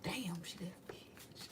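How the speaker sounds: slow hushed whisper of admiration; slow impressed whisper from the world; awed whisper. Pretend. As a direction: breathe it out, impressed. World reacting in awe.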